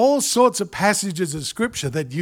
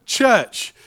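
ordinary talk, nothing marked

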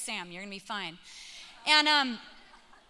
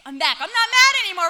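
put-on fast, loud, high excited kid; rushed gleeful shout; happy announcement; 7 semitones higher, 12 dB louder, faster than usual. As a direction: imitate a child bursting back in. Son happily returns upstairs.

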